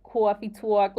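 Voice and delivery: loud, punchy New York accent. Shown as a demonstration. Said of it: "stereotypical New York accent".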